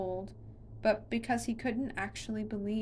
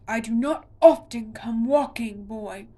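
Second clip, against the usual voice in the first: monster voice; 12 dB louder than usual. A narrator's reading. Monster squeezing Connor.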